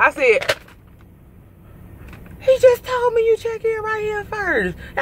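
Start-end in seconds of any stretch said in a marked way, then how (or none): 2.4-4.8 high indignant protest; mild retold protest. Trucker arguing with the check-in clerk, high and indignant. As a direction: push back at the clerk in a high, exasperated voice. Arguing with staff, getting mad.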